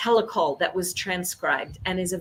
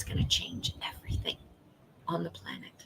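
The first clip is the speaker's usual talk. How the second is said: whisper; 3 semitones lower, 12 dB quieter, faster than usual. Real, not acted. Whispered line in a pep talk.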